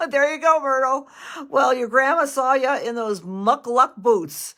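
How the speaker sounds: excited. Real, not acted. Medium telling Myrtle about the boots, excited and loud. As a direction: tell it with real glee. Excited after the funny message.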